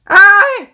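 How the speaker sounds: high, loud yelp of fright; frightened scream. Real, not acted. Gamer found under the bed, frightened scream. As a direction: scream as you are discovered. Old woman bends down to find her.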